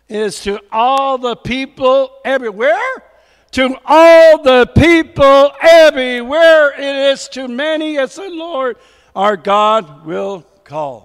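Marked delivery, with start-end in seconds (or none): 3.5-6.7 proclaiming. Acted. Preacher proclaims the repeated line.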